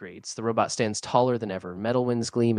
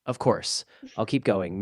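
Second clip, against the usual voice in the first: plain reading voice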